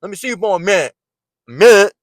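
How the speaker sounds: "minute" slurred to "mit", pitched high; mock the speaker who swallows "minute"; high imitation with "minute" mangled; mocked mispronunciation.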